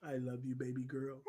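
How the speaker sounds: soft whispered I love you. Real, not acted. Tender aside to his baby daughter.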